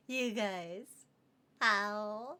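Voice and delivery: ordinary talk, nothing marked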